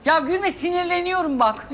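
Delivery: ordinary talk, nothing marked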